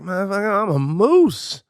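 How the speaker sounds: high, stretched-out sassy moose; mock indignation, drawing out the last word; indignant moose. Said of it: "And she's like".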